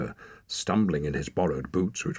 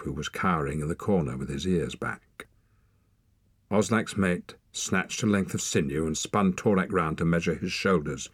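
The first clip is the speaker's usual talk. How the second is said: usual speaking voice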